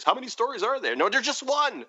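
high-pitched, put-on question and retort; imitated back-and-forth, voice jumping high; mock exasperated exchange. Acted exasperated question and answer.

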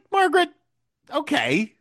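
very high, mock exasperation; chiding appeal. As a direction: gently tell her off in a high voice. Chiding a character as if present.

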